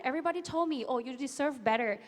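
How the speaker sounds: ordinary talk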